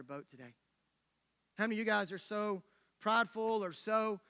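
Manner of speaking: soft, low, intimate closing appeal; solemn preaching. Real, not acted. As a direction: bring it right down and challenge them gently. Preacher's hushed closing challenge.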